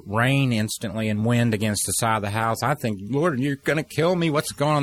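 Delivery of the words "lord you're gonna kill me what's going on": higher, startled cry to the Lord; alarmed plea. Retold or imitated. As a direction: relive the scare, pitched up. Panicked cry to God in storm.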